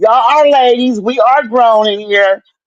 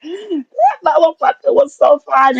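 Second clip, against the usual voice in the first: laughing; 7 semitones higher, slower than usual. Real, not acted. Gushing over the handsome nurse.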